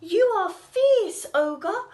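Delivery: high, loud, impressed cry; impressed cat. Acted. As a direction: gasp at how fierce he is. Cat flatters ogre.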